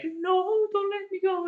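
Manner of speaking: high, panicked mock cry; desperate plea. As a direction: beg in a squeaky falsetto not to be dropped. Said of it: "Vi is like".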